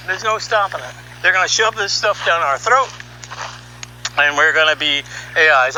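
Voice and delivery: usual speaking voice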